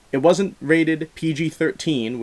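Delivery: ordinary talk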